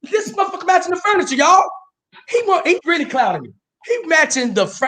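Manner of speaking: hammed-up jealous rant about the shirt; mock outrage.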